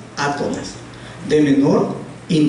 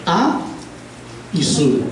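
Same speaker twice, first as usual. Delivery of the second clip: separated syllables; 2 semitones lower, 3 dB quieter, slower than usual. Shown as a demonstration. Teacher dividing azul for the class, syllable by syllable. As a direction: split the word into "A" and "sul", each alone. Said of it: "separada en sílabas también".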